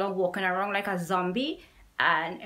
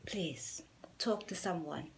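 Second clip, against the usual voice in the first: whispering; 8 dB quieter, slower than usual. Real, not acted. Heartfelt plea to viewers.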